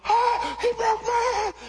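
high, yelping pained cry; retelling a player's scream as a joke; mock scream. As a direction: squeal it like a hurt man, hammed-up. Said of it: "he's screaming and hollering".

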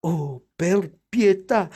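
embarrassed, drawn-out exclamation; mortified plea. A narrator's reading. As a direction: beg for mercy, drawing the words out. Caught dressed up, she begs mercy.

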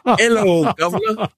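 chirpy Scottish hello, governor; imitating Scottish greeting. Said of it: "every day they'd say this".

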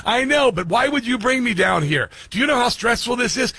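high-pitched mock complaint, exasperated; mad GPS voice. GPS complaining to driver about being brought here, mock mad. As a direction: imitate a stressed-out GPS nagging the driver. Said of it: "She was sounding mad".

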